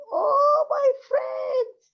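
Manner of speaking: donkey stuck in the well screams to friends for help. Acted. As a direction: wail for rescue from the well. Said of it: "he started to scream".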